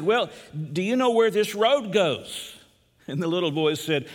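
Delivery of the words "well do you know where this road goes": slow, high-pitched insistent question; lost man pressing. Retold or imitated. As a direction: play a lost traveller trying once more. Lost man asks again.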